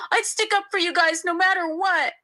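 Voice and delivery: high, tearful wail. A narrator's reading. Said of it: "she wailed".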